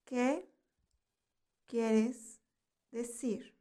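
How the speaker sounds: "¿Qué quieres decir?" one word at a time, long pauses between; word-by-word teaching. Shown as a demonstration. Teacher lets learners repeat "¿Qué quieres decir?", word by word with pauses.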